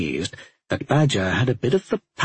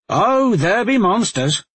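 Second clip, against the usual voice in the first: high, loud spooky tease; spooky mocking; 10 semitones higher, 4 dB louder than usual. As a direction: play it like a campfire ghost story. Talmadge teases with spooky line.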